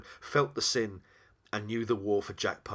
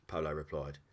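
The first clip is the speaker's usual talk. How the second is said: ordinary delivery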